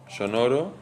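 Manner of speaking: normal talking voice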